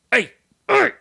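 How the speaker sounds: high, loud retold yell. Woman shooing a mouse while making a trap, yelling. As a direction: shout to chase off a pest. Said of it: "I'm yelling at this mouse".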